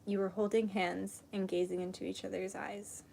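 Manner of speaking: soft, hushed meditation voice; guided meditation. Acted. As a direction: guide them gently into calm. Leads a meditation and prayer.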